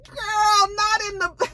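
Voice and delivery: high, mock-wailed objection; upset protest. Viewer protests Monet's death, high mock wail. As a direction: wail it in high-pitched dismay.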